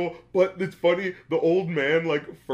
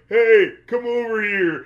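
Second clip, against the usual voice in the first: loud, slow, higher call across a distance; calling from far away; 9 semitones higher, 8 dB louder, slower than usual. Retold or imitated. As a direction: stretch it out to reach someone far off. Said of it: "like, really far away".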